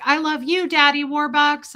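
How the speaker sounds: high, loud movie-orphan gushing; Annie. Retold or imitated. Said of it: "like an Annie movie".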